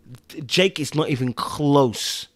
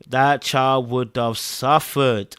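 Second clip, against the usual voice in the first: drawn out, word by word; emphatic slow repeat; much slower than usual. Real, not acted. Viewer pitying the child, slow emphatic delivery. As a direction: stretch it slowly to make the point.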